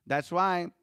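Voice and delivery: ordinary talk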